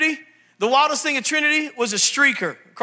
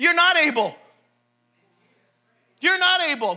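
ordinary delivery

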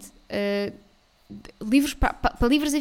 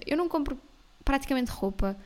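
ordinary delivery

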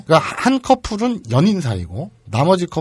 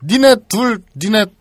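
imitating drunk woman; 7 semitones higher, 5 dB louder, slower than usual. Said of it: "키스했던 여자가".

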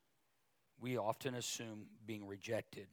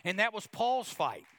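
high, loud proclamation; rising preacher's call; preaching shout; 9 semitones higher, 7 dB louder than usual. Real, not acted. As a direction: ring it out to the congregation. Preaching climax.

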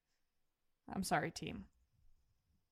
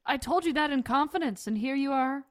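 loud, high mock indignation; mock outrage; 5 semitones higher, 7 dB louder than usual. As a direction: pretend betrayed, raising your voice. Mock outrage at a leaked secret.

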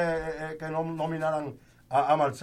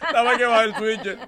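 laughing through the punchline; 5 semitones higher, 6 dB louder than usual. Real, not acted. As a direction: laugh out the words.

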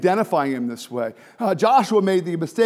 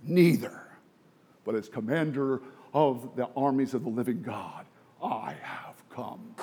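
solemn reply; 6 semitones lower, 6 dB quieter than usual. Retold or imitated. Commander answering Joshua, low solemn hush. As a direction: answer with hushed majesty.

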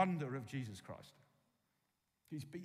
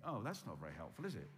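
lower, dismissive mock reply, is it?; deflated skeptic scoffing lightly; unimpressed listener; 6 semitones lower, faster than usual. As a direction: voice the doubting listener shrugging it off. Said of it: "You think".

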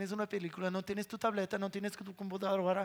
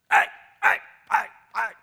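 loud exasperated cries; hammed-up shouting parent; mock yelling; 2 semitones higher, 13 dB louder than usual. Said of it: "No quiero gritarles".

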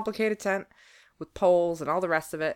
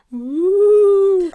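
loud high woo; 13 semitones higher, 12 dB louder than usual. Shown as a demonstration. Offering sound effects, loud high woo. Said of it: "I come with my own sound effects".

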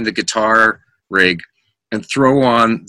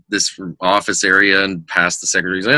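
ordinary talk

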